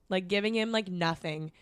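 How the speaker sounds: ordinary talk, nothing marked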